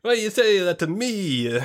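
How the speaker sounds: high, loud offended mobster chef voice; indignant chef. Acted. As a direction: squawk it like an insulted Sopranos cook. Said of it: "He's gonna be like".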